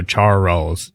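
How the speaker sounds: Charles split into CHAR-rolls; two-syllable demonstration. Shown as a demonstration. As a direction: say Charles in two beats, chin dropping on each. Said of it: "times your chin goes down".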